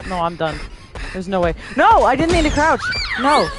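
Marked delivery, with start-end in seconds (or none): 1.8-3.5 being chased. Real, not acted. Chased, shouting while fleeing.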